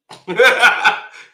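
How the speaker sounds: short, high burst of laughter; big real laugh; loud laugh. Real, not acted. Laughs at his own warning.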